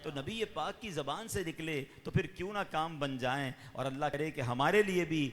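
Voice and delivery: normal talking voice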